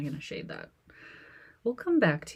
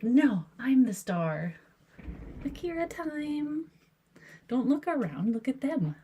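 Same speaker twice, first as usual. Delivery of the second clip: voicing the cat; 7 semitones higher than usual. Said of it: "Akira's like".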